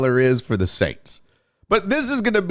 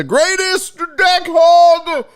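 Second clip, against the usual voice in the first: high, loud drawn-out announcer shout; big theatrical high-pitched proclamation; hype announcer; 4 dB louder, much slower than usual. Acted. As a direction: belt out a grand show intro, stretching the words. Show's signature hype introduction.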